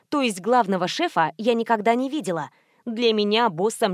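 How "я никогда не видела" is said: plain reading voice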